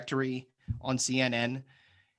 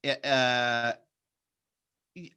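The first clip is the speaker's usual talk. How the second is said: normal talking voice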